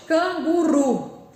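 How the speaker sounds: Canguru slowly, landing hard on RU; stressed last syllable. Shown as a demonstration. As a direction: punch the final RU of Canguru. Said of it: "a sílaba de tom mais forte".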